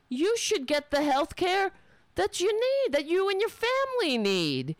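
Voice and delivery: high-pitched mocking politician impression; Pete Buttigieg. Quoting a candidate on healthcare, in a high mocking voice. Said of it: "as Pete Buttigieg says".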